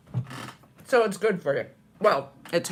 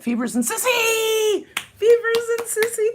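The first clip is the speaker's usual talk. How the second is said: high shout of names; excited call-out; 13 semitones higher than usual. Real, not acted. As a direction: call out to friends across the room. Calling out to friends.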